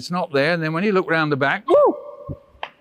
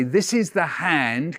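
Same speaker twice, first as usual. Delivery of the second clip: slow recitation; slower than usual. Shown as a demonstration. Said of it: "Can you say that after me?".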